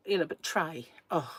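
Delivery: ordinary talk, nothing marked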